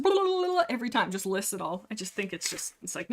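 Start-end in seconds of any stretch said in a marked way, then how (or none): 0.0-0.6 high-pitched rolled blah-blah rattle; mocking rattle. Reader mocking a cat endlessly listing sins, high rattle. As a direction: mimic an endless recital, high and babbling.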